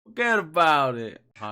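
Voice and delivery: loud, drawn-out mock New York Italian; New York accent. Guest answers his introduction in a loud put-on New York accent. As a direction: belt it out like a hammed-up New Yorker. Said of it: "Doing the best New York accents this episode".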